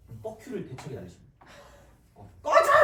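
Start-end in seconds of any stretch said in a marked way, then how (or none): 2.4-2.8 very high, loud dismissive shout; mock yell. Teacher acting out dismissing the exam trap, high mock yell. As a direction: yell it in a hammed-up outburst. Acts out telling off a problem.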